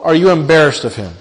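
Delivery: ordinary talk, nothing marked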